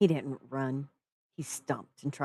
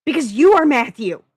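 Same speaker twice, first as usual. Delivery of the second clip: much higher, louder desperate outburst; blurted out; 11 semitones higher, 16 dB louder than usual. Acted. Max finally confessing to the narrator, high and loud blurt. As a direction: burst it out before he can stop himself. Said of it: "Max blurted out".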